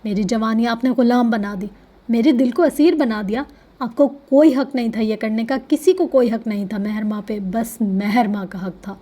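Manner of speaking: plain reading voice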